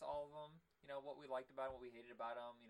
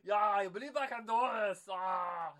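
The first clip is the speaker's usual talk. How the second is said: higher, much louder pumped-up yell; mock hype shout; 6 semitones higher, 15 dB louder than usual. Schwarzenegger answering Stallone, hammed-up excited shout. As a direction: roar it like a hammed-up action star. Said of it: "if Stallone and Schwarzenegger are just sitting around going".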